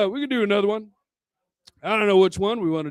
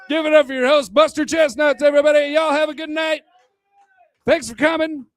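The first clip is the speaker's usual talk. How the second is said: higher, louder host hype; emcee sign-off; 6 semitones higher, 5 dB louder than usual. Real, not acted. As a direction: bring the crowd up for the host.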